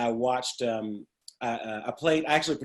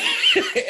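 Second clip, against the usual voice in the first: sharp high burst of laughter; 6 dB louder than usual. Real, not acted. Laughing while remembering classmates. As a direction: break into a quick laugh.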